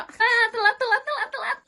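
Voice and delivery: frantic, loud repeating late; rushing in, gasping out late; panicked late. Acted. New girl rushing in late, panicked.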